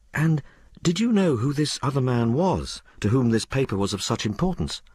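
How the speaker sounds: high-pitched, ladylike questioner; well-bred mistress Madge. Acted. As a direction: question the maid as her refined mistress. Story figure Madge.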